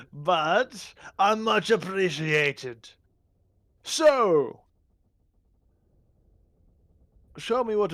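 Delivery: grand, drawn-out royal condescension; haughty goblin king. Acted. Goblin king inspects the visitors' new trinkets, haughty and grand. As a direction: weigh their gifts like a haughty monarch.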